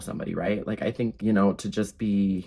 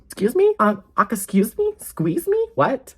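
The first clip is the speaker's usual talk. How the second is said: much higher, louder incredulous squeals; shocked outrage; 11 semitones higher, 6 dB louder than usual. Real, not acted. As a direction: shriek your disbelief. Outraged reaction to birthday lie.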